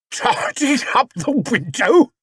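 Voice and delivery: high, slow disbelieving echo; spluttering. Acted. Detective repeating the bear's excuse, high and spluttering. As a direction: splutter in outrage. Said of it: "the detective spluttered".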